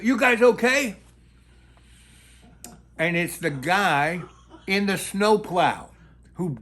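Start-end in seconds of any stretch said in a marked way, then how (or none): 0.0-1.0 raised, higher-pitched urgent call. Retold or imitated. Snowplow guy checking on crash victims, calling out loudly. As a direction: shout it to check on them. Rescuer calling into the buried car.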